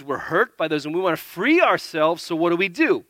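usual speaking voice

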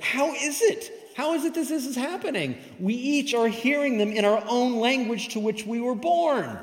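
high-pitched, incredulous questioning; astonished crowd. Acted. Crowd hearing their own language at Pentecost, astonished.

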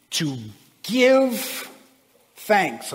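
to give thanks, with give lifted high and held; stress on GIVE. Shown as a demonstration. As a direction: lean hard on the word give. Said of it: "I'm gonna put the emphasis on the word give".

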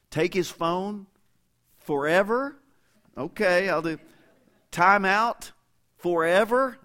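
high, loud questions rising in disbelief; mock incredulous listing. Preacher as a parent reading out his son's revenge list, loud mock disbelief. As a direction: read each punishment back in mock amazement.

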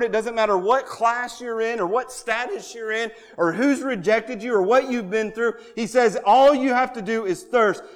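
impassioned preacher; fervent preaching. Acted. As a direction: hammer the point home. Preaching at full force.

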